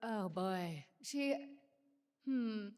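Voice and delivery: ordinary talk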